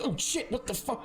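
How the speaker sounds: high, alarmed squeal; second startled guard. Acted. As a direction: play a guard freaking out. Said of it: "the other one goes".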